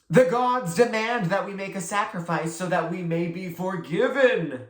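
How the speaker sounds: grave priestess decree; solemn pronouncement. A narrator's reading. Melanie announcing the gods' demand as a solemn decree. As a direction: proclaim it like an oracle.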